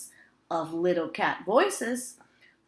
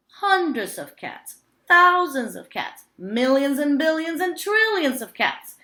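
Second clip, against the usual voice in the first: rising, louder counting up; building up; 7 semitones higher, 6 dB louder than usual. A narrator's reading. Old man boasting of all the cats he has seen, building louder. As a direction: build the count to a proud peak.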